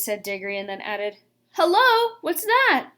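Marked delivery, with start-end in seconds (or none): 1.5-2.9 sudden high exclaim; surprised. Acted. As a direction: caught off guard, calling out. Diggory spots something.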